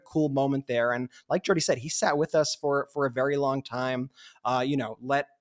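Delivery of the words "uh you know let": ordinary delivery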